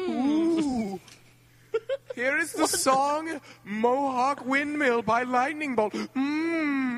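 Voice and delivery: high, slow hammed-up announcer; dramatic announcer voice. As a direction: stretch the intro out for mock drama. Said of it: "the dramatic announcer voice".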